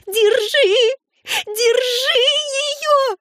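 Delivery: extremely high screamed command; shrieking. A narrator's reading. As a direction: screech it frantically, holding the high pitch. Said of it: "Энн завизжала ещё громче".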